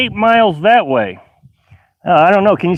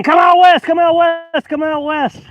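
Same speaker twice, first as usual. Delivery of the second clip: imitating eager urging; 10 semitones higher than usual. Imitating everybody urging them west.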